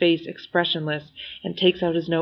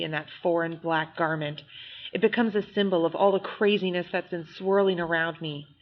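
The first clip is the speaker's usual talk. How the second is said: ordinary delivery, nothing marked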